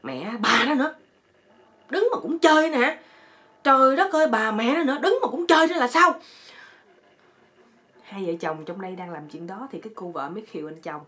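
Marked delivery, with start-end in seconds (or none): 0.0-6.1 high, loud comic outburst; hammed-up cursing yell at mosquitoes; exasperated yelling. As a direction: shout in fed-up outrage. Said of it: "Cái nó la lên".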